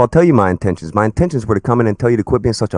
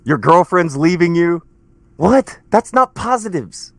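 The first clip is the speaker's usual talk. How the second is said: much higher, slowing down in mock disbelief; like you can't believe what you just heard; jeering disbelief at a rival's logic; incredulous; 6 semitones higher, slower than usual. Real, not acted. Incredulous mockery in argument.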